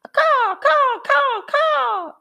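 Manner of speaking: very high, loud cawing crows; cackling birds. A narrator's reading. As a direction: caw like a mocking flock of blackbirds. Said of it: "cackled the birds".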